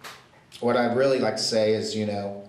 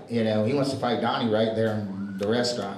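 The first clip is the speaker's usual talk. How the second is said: usual speaking voice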